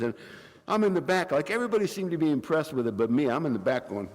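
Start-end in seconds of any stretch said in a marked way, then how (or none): none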